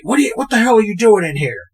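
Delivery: imitated indignant outburst; irate. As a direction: retell an outraged coach bursting in. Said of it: "all irate".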